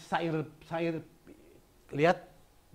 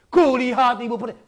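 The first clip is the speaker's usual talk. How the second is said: loud, high, flat declaiming; loud wrong reading; 7 semitones higher, 11 dB louder than usual. Shown as a demonstration. Teacher showing pupils the wrong way, loud and high. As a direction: blare the line out evenly as the wrong way.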